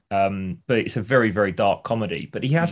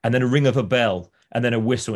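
ordinary delivery

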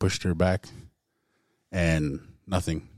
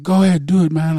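higher, louder dare; defiant shout; 11 semitones higher, 10 dB louder than usual. Retold or imitated. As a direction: shout it back at her, daring. Defiant reply to wife.

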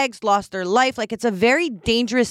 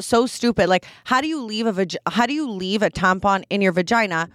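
usual speaking voice

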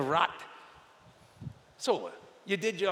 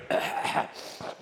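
fake cough. Shown as a demonstration. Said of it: "So I tried to fake it".

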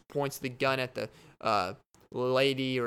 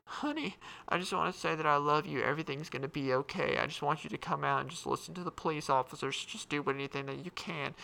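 mom pleading; 3 semitones higher than usual. Acted. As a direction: coax him out gently like a worried mother. Mom pleads over megaphone.

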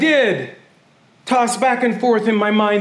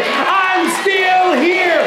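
shouted declaration; 7 semitones higher, much slower than usual. Shown as a demonstration. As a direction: cry it out slowly to the whole church. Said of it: "Would you say it".

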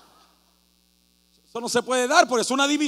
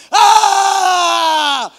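loud high mock yell at him; crowd yell; 8 semitones higher, 12 dB louder than usual. Preacher imitating the crowd yelling at him, loud. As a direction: yell at the lone rival fan.

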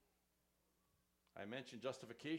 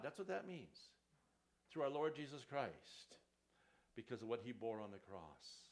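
ordinary talk

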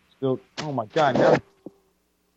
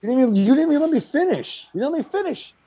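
indignant protest; 12 semitones higher than usual. Real, not acted. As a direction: protest being cut off, voice jumping up high. Protests being cut off and corrected.

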